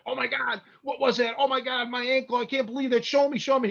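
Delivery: high, pained retold cries; mock agony, pitched well up; pained yelp. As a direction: yelp it out, half laughing in pain. Retold pain from toe hold.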